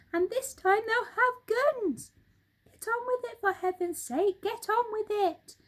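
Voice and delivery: high, loud and fast frenzied monkey; frantic urging. Acted. Mugglewump in a frenzy urging everyone up, frantic. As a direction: whip them into action, breathless. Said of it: "frenzy of excitement".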